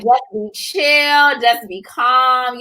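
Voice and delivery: slow, drawn-out soothing words; mock calm advice. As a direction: imitate him telling her to relax, slowly. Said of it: "he was like".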